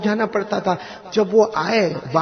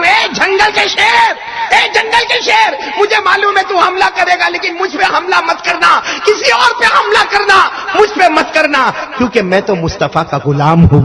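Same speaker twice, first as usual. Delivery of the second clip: pleading shout to the lion; 13 semitones higher, 7 dB louder than usual. Acted. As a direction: beg the lion to spare him. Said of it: "انہوں نے کیا کہا معلوم".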